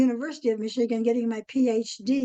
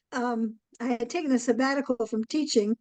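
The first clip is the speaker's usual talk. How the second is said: ordinary delivery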